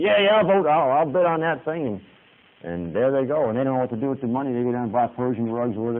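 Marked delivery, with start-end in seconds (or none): 0.0-1.8 higher and louder, mock frantic trader; traders bidding. Preacher mimicking Chicago traders bidding, hammed-up excited. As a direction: shout bids like a trading floor. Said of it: "wave their hands and say".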